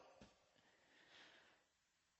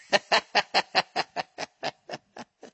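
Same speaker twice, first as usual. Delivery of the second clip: long wheezing laugh; 6 semitones higher, 4 dB louder than usual. Real, not acted. Comedian picturing himself running in socks, helpless laughter. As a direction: crack up at your own story, laugh trailing away. Laughing at his own story.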